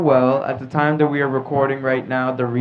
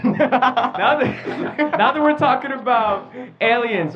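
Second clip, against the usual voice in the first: higher-pitched, breaking into laughter; laughing while talking; 10 semitones higher, slower than usual. Real, not acted. Host restarting the aliens topic, laughing. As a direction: laugh through the words.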